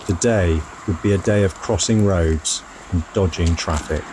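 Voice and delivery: calm, measured travel voiceover; voiceover narration. A narrator's reading. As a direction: tell it like a reflective travel diary.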